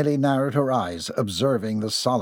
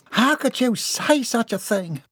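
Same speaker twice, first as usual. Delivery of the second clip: high-pitched, scandalized rebuke; appalled outrage; 12 semitones higher than usual. A narrator's reading. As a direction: reproach him in a high, outraged voice. Wife appalled at husband's words.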